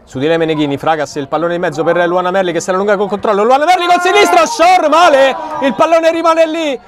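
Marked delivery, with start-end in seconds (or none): 3.4-6.8 excited. Real, not acted. Near goal, keeper fumbles.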